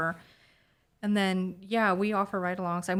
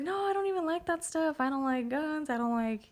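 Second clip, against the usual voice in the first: high anxious refusal; a fearful mother pleading no; scared mom voice; 9 semitones higher than usual. Retold or imitated. Scared mom refusing ride-along.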